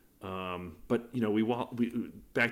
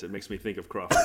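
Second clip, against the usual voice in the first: laughing; faster than usual. Real, not acted. Laughing at co-host's accent.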